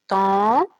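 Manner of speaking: "tente" stretched out slowly, final t sounded; lentement. Shown as a demonstration. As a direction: draw out "tente" for the class. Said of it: "Et dans la fin du mot, on dit te". ("tente" étiré lentement, t final prononcé)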